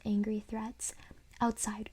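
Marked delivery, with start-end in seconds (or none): none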